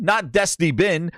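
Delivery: ordinary talk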